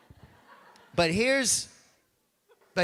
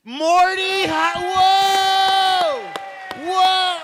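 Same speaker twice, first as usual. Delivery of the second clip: high loud whoa of surprise; delighted shout; 13 semitones higher, 10 dB louder, much slower than usual. Real, not acted. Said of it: "I love that".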